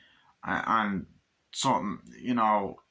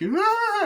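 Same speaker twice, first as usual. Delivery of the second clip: mock pained groan; 7 dB louder than usual. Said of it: "he's like".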